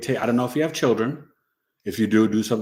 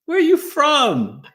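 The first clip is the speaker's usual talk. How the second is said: high, sing-song falsetto hello; high falsetto greeting; 7 dB louder, slower than usual. Pretend. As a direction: greet her in a squeaky high voice. Greeting a viewer in a put-on voice.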